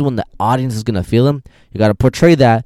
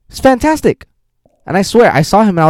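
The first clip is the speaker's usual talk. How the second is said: very high-pitched, louder rave; gushing praise; 11 semitones higher, 5 dB louder, faster than usual. Real, not acted. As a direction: gush in a soaring high voice.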